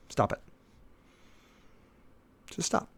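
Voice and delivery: ordinary talk, nothing marked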